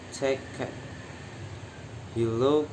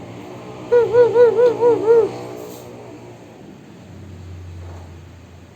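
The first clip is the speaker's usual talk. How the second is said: very high squeaky monkey tsz-tsz; monkey chatter; 8 dB louder than usual. A narrator's reading. Monkeys mocking the peddler, very high chattering. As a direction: chatter back like cheeky monkeys. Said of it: "at him and sighed".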